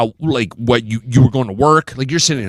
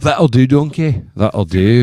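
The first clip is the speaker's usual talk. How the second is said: Shrek impression; 5 semitones lower, slower than usual.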